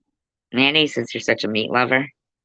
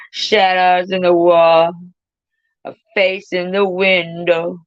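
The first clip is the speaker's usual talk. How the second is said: higher, louder, slow spooky recital; creepy; 7 semitones higher, 5 dB louder, slower than usual. Acted. Reciting lines to friends, slow and spooky. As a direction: make it eerie and drawn out. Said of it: "That seems creepy".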